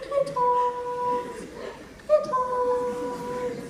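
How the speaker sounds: high sing-song call; calling the glitons. Shown as a demonstration. Teacher calling tiny dragons from the trees in a high sing-song. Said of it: "you just go".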